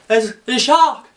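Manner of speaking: high, frantic alarm; over-the-top frightened beachgoer; mock panic. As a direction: babble a hammed-up warning to the whole beach.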